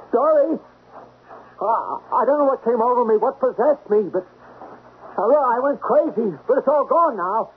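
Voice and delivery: high, strained, crazed voice; unhinged pleading. Acted. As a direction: plead like a madman. Deranged pleading after her.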